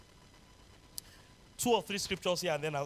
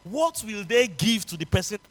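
shout; 3 semitones higher, 9 dB louder than usual. Retold or imitated. Shouted question in retold story.

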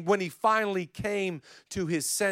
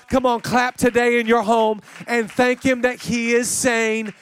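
preaching; 4 semitones higher, 6 dB louder than usual. Acted. Preacher building energy.

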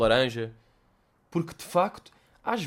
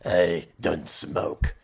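put-on much lower, very slow sinister old smoker; raspy cancer voice; 8 semitones lower, much slower than usual. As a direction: croak it like a throat-cancer survivor. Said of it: "aquela voz toda tipo de cancro". (voz de velho com cancro, grave e lenta)

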